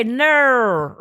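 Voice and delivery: Australian "No" stretched with a far-off vowel. Shown as a demonstration. Showing friends how Australians say no, Australian vowel. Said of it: "they say".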